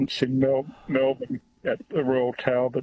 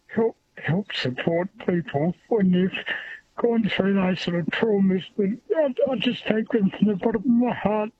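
teary; 7 semitones higher than usual. Real, not acted. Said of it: "a bit teary now even thinking about it".

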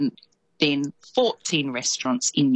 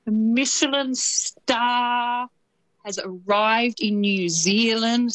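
"Michelin star" drawn out slow and higher, playfully grand; jokingly grand reveal, slow and higher; mock fancy; 7 semitones higher, slower than usual. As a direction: savour "Michelin" like a fancy menu item, hammed-up. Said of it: "we're so fancy this week".

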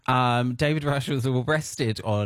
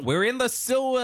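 much higher, eager invitation; mock eager American; 11 semitones higher than usual. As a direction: call it out like a gleeful tourist. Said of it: "But America's like".

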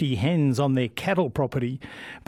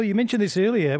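usual speaking voice

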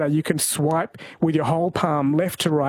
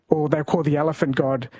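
ordinary talk, nothing marked

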